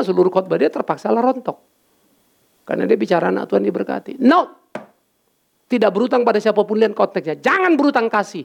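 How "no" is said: higher, louder flat refusal; emphatic no. Real, not acted. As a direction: reject it outright. Rejecting other pastor's sermon. (menolak dengan tegas dan keras)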